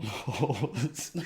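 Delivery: slow, laughter breaking into the line; cracking up. Real, not acted. Joke teller reaching his own punchline, cracking up. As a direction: try to deliver it and burst out laughing.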